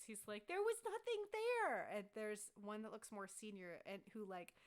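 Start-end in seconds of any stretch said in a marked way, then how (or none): 0.5-1.9 high, loud, drawn-out frustrated wail; stretched, high-pitched cry of exasperation; tearful frustration. Acted. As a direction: play a guard at wit's end, nearly in tears. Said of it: "he's, like, in tears".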